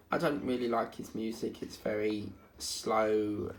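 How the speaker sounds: normal talking voice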